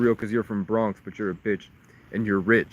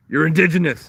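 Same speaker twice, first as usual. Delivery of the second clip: louder, higher declaration; emphatic shouted revelation; 7 semitones higher, 7 dB louder than usual. Acted. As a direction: proclaim it as a loud reveal. Shouted revelation in acted confrontation.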